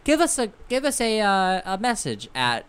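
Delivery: loud, high hammed-up ad pitch; mock announcer. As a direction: sell it like a radio ad.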